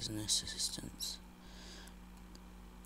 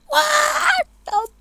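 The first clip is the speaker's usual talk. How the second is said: a long, high, crying shout of disbelief; shocked shout; 16 dB louder, slower than usual. Real, not acted. Gamer yells in shock after dying. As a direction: yell it out slow and pained at the screen.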